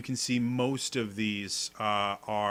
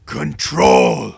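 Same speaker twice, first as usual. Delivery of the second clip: loud, strained creature cry of a single word; creature shouting; 15 dB louder than usual. Acted. Creature shouting at the party to take control. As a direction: bark it like a creature fighting for speech. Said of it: "He said".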